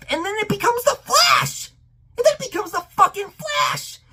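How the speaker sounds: high, incredulous squawk; exasperated disbelief. Real, not acted. Fan complaining the symbiote becomes the Flash, exasperated disbelief. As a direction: voice shooting up in exasperation. Ranting at absurd plot turn.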